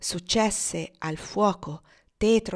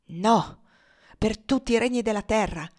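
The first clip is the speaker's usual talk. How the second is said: louder, resolute no; firm refusal; 3 semitones higher, 5 dB louder than usual. A narrator's reading. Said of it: "rispose Tom con fermezza". (rifiuto fermo e deciso)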